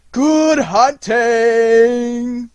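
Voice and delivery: drawn-out howling call, slow and loud; long wolf howl. Acted. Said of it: "cried a long, long".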